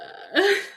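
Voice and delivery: a loud, high burst of laughter. Real, not acted. Laughing loudly at the stunned lifeguard punchline. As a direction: laugh out loud and high at your own story.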